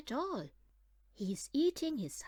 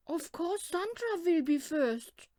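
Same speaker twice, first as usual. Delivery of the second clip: teasing little sibling; 7 semitones higher, 6 dB louder than usual. Acted. Squirrel kid teases about sister.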